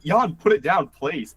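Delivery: high-pitched retold pleading; imitated exasperated begging; acted-out urgent plea. Friends urging Jan in retelling.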